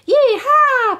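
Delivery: very high, loud gleeful whoop; high loud yee-haw; excited yee-haw. A narrator's reading. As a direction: whoop like a cowboy mouse. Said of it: "panted Millie".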